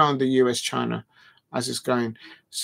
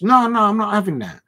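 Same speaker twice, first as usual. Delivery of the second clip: raised, louder outright refusal; indignant refusal; 7 semitones higher, 6 dB louder than usual. Real, not acted. Streamer calling the rumour rubbish, loudly indignant. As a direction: flatly reject it with rising pitch and volume.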